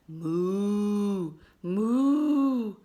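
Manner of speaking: storybook cow calling loudly; cow mooing. A narrator's reading. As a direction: moo out loud like a cow, rising on the repeat. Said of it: "said Cow quickly".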